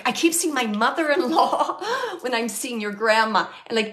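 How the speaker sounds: ordinary delivery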